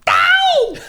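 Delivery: loud, very high "ow"; shouted falsetto yelp. Acted. Capping the bassline, high yelp.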